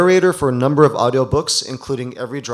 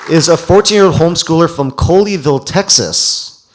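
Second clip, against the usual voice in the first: much higher and louder, calling the name out; excited introduction; 5 semitones higher, 9 dB louder than usual. Real, not acted. Host introducing the young winner to the crowd, excited and loud.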